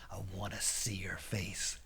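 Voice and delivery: soft, low-pitched demand; quiet, firm challenge. Acted. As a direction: drop down low and dare them. Policeman demands to see face.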